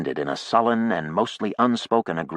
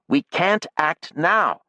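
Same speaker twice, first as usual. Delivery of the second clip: sharp, slowed, higher retort; 11 semitones higher, 4 dB louder, slower than usual. A narrator's reading. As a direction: cut her off sharply. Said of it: "she said sharply".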